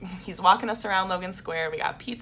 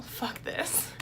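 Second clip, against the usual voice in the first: teary aside; 5 semitones higher, 9 dB quieter than usual. Real, not acted. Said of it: "I'm getting emotional".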